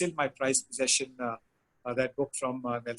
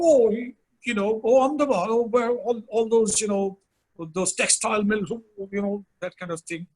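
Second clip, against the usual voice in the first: high, loud exclaiming voice; animated higher-pitched impression of a royal; Prince Philip; 5 semitones higher, 4 dB louder, faster than usual. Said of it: "so he said".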